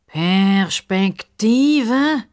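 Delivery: "Perspektive?" stretched very slowly and pompously; pompous crocodile drawing out the word; drawn-out important word. Acted. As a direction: savour the word like a know-it-all.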